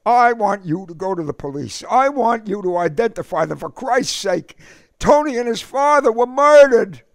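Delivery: high, rising to a loud cry on murdered; desperate plea. A narrator's reading. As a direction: beg him, then break into a shout. Eddie begs K O for justice.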